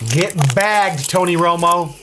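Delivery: high, gleeful jeer; gleeful taunt. Real, not acted. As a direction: gloat over the arrest.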